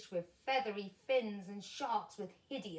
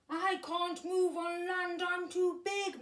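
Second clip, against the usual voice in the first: higher, louder, lightly voiced whale moan; 9 semitones higher, 6 dB louder than usual. A narrator's reading. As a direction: complain helplessly. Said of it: "moaned the whale".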